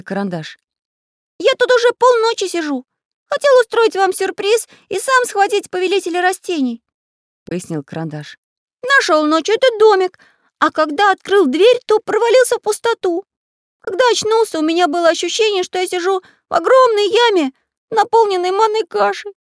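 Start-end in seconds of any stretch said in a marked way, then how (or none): 1.4-6.8 high, loud cartoon explaining; squeaky pencil character. A narrator's reading. Pencil explaining his plan to friends, squeaky. 8.8-19.3 high, loud cartoon explaining; squeaky pencil character. A narrator's reading. Pencil explaining his plan to friends, squeaky.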